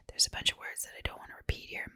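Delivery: usual speaking voice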